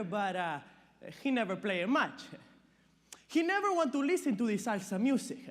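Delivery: put-on chatty immigrant dad with accented English; lively at first, then chuckling and hesitant. Cesar talks to the therapist about his son's music, chatty and awkward.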